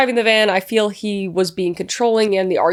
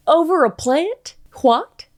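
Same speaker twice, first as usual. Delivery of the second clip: incredulous; 7 semitones higher, slower than usual. Real, not acted. Reacting in disbelief to the post.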